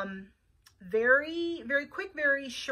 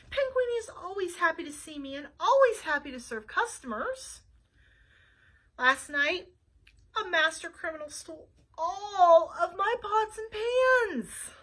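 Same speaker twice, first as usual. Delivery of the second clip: a lightly higher chef's lament; Panguini voice; 5 semitones higher than usual. Acted. Chef telling Flytrap about the theft, mildly woeful. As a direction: explain the loss, a bit woeful.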